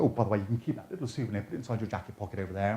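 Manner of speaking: quick, soft throwaway patter; casual aside. Acted. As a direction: slip it in quietly, low and fast. Casual patter to spectator at table.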